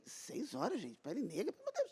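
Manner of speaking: hushed, breathy disbelief; barely voiced amazement; awed murmur; stunned whisper. Retold stunned reaction.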